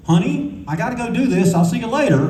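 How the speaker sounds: chirpy high brush-off to the wife; high mock husband. Spiegelman telling his wife he's leaving.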